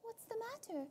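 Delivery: light, high questioning voice; high curious girl voice. Acted. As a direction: ask brightly, high and soft. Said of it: "Ludmilla asks".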